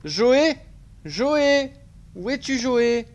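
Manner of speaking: high, loud call across the house. Pretend. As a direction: shout the name to find him. Calling out for a character.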